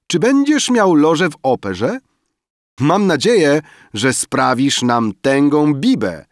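high, loud teasing reveler; rozbawiony okrzyk. A narrator's reading. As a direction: call out merrily over a banquet, high and loud. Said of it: "Okrzyki rozbawionego zgromadzenia". (rozbawiony, wysoki okrzyk biesiadniczki)

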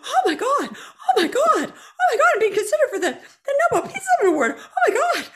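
very high, loud, laughing oh my gods; breathless, laughing, delighted shrieks; excited squealing. Retold or imitated. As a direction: squeal with giddy disbelief. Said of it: "And I was like".

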